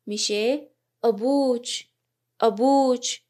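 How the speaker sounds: ابوچ said slowly, ending in "ch"; Arabic demo. Shown as a demonstration. Teacher modelling ابوچ as "abuch" for students, careful.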